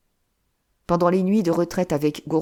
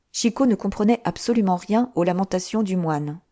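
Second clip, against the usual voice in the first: ordinary delivery, nothing marked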